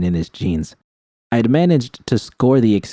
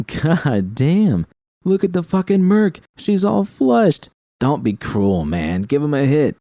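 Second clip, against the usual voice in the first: jeering thugs; 7 semitones higher than usual. Acted.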